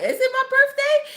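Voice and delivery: high, puzzled mock question; exaggerated high-pitched confusion; confused. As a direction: ask it way up high, amused and unsure. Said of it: "I was so confused".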